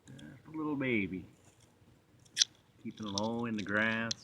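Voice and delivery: hushed and soft so as not to scare it; wildlife watching whisper. Real, not acted. Quietly watching a baby animal.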